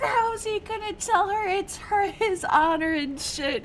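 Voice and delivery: high-pitched mock tearful complaint; imitated crying. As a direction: whine it out like someone in tears, hammed up. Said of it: "I'm crying over here".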